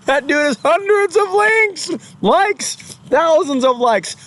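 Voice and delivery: high, far-off mock voice; mocking whine. Vlogger mocking a YouTuber who calls himself abused, high mock whine. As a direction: whine it like a wounded victim.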